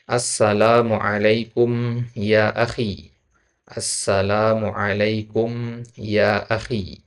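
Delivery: slow, careful reading for students to repeat; slow model reading. Shown as a demonstration. Teacher reads aloud twice so the class can repeat. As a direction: stretch each syllable of the greeting. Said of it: "ana bacakan dulu ya, dua kali".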